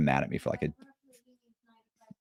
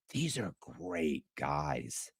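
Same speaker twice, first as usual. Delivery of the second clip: hushed inner thought; 4 semitones lower, 14 dB quieter, much slower than usual. Retold or imitated.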